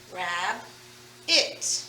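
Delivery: "Rab", a clear pause, then a soft "it"; split into syllables. Shown as a demonstration. Teacher splitting rabbit for pupils, two soft separate syllables. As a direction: break it softly into "Rab" and "it". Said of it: "the syllable it".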